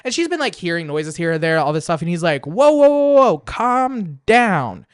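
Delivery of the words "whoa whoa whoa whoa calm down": lightly voiced monster calming her, higher and slow; hammed-up drawn-out whoa; soothing monster. Monster voiced soothing the screaming woman, mock calm.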